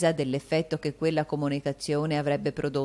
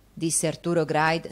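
plain reading voice

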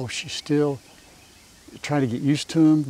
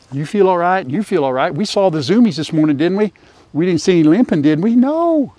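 louder, higher, coaxing questions to an animal; doting pet talk; 4 semitones higher, 6 dB louder than usual. Real, not acted. Owner checking on the pet after the zoomies, doting pet talk. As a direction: fuss over the pet, lifting high on the final No.

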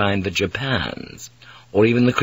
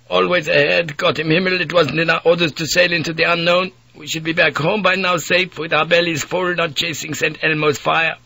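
put-on much higher, insistent Dutch-accented complaint; ranting Dutch mate; 8 semitones higher than usual. Mate railing against sailing the unknown, higher Dutch voice. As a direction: pour out the grievance in one breath.

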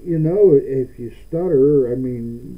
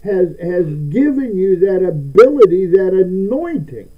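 preaching; 6 semitones higher, 7 dB louder than usual. Acted. Preaching at full force.

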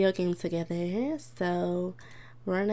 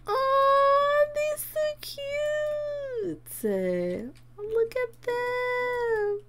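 high, slow, stretched-out coo; high drawn-out aww voice; much slower than usual. Real, not acted. Cooing at cute Sims couple.

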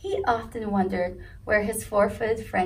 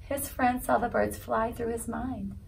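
plain reading voice

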